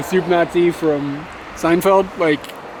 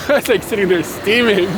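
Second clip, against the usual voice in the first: laughing; 3 semitones higher than usual. Real, not acted. Laughs recalling his anger.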